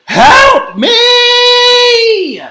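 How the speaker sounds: very high, loud cry for help; thunderous shout. A narrator's reading. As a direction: thunder it out, stretching the words. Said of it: "Gabby thundered".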